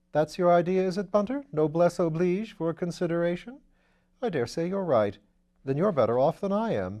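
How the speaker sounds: put-on an airy young lord teasing his valet; Lord Peter. Lord Peter bantering with Bunter.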